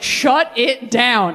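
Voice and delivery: very slow, drawn-out decree; slow final shut it down. Retold or imitated. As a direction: close the book on it slowly. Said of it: "I was like".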